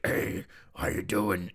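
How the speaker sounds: lightly done low, slow wacky caller; a hint of a creepy disguised voice on the phone. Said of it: "he's speaking in a wacky voice".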